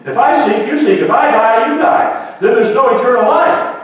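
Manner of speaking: loud rebuking preacher's voice; fiery preaching. Acted. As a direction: thunder the rebuke at the disciples. Preaches Jesus' rebuke at full force.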